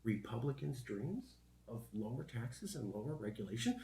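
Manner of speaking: hushed, dramatic news-anchor whisper; newscaster voice. Pretend. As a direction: whisper it like a breaking news teaser. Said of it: "my newscaster voice".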